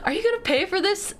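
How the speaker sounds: cashier's question, pitched high; high mock cashier. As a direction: play a chirpy cashier.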